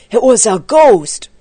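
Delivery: loud, emphatic insistence; insistent. A narrator's reading. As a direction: insist forcefully on being believed. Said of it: "insisted Lester".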